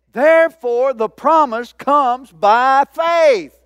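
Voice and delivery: building sermon climax; full-force preaching. Acted. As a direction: drive each phrase home to the church.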